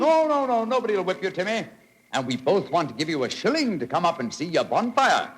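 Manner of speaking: high, coaxing call upward. Acted. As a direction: reassure the scared boy from far below. Coaxing the boy from below.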